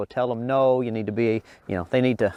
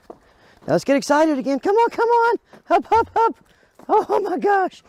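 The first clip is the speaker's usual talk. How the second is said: very high, louder hype-up; excited squeal; 4 dB louder than usual. Real, not acted. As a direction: rev the dog up with a squeal. Hyping up the dog.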